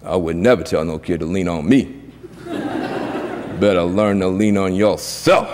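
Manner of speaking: low-pitched, unimpressed dad reply; stern father. Retold or imitated. Daddy answering his son's comparison, stern.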